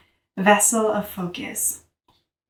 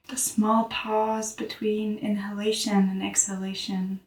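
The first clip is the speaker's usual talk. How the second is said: guided meditation; 4 dB quieter than usual. Real, not acted. Teacher guiding listeners to notice their breath, calm meditative voice. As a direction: guide the breath gently, leaving long silences.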